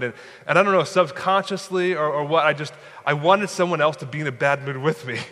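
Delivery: ordinary talk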